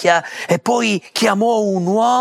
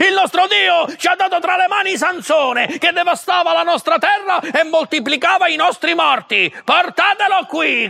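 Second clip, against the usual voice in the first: gloating cheer; 8 semitones higher than usual. A narrator's reading. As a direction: crow over the captured enemy, much higher. Philistines celebrating Samson's capture.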